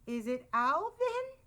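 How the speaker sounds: nervous question climbing very high; nervous gulp. A narrator's reading. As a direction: let the question rise in fright. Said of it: "Rabbit gulped".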